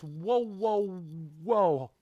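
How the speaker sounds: loud, high, drawn-out whoa; startled whoa. Real, not acted. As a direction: hit the brakes in disbelief. Stops the reading in disbelief.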